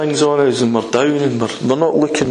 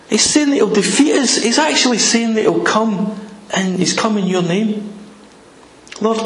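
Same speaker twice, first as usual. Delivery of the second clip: higher, pleading voice of worried people; anxious pleading prayer; 8 semitones higher than usual. Acted. Praying people beg God, high and pleading. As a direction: beg God to look at the threat.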